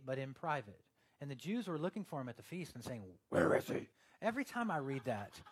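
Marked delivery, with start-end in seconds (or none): 3.3-3.9 very low, loud voice; Batman. Acted. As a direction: growl it like a caped vigilante. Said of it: "I read it in the Batman voice".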